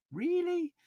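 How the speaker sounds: high disbelieving "Really?"; a sharp rising squeak of disbelief; incredulous. Retold or imitated. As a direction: shoot the word way up in disbelief. Incredulous reaction to 2021 claim.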